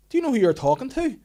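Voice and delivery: mock pompous fury; indignant demand. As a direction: demand it like an offended big shot. Angry caller demanding on phone.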